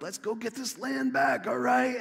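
high-pitched imitation of eager allies; mock rallying cry. Voices kings rallying each other.

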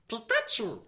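high-pitched mascot cry; Pikachu. Acted. Fan mocking the cartoon's repetitive creature, high Pikachu voice. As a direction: squeak out the creature's own name. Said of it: "Pikachu just being like".

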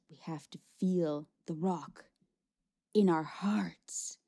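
very soft, earnest hush; hushed, slowing, heartfelt plea; tender near-whisper. Acted. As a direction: breathe it like an intimate confession. Hand on heart, tender moment.

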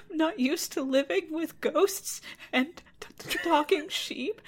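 high stammering weepy voice; tearful high whine. Acted. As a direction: sob through the stammer. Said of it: "And she says".

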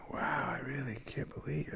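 breathless awed whisper; hypnotized whisper. Retold or imitated. Meditator lost in a spiralling thought, whispering in awe. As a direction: hush it out, spellbound by a thought. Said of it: "I'm like".

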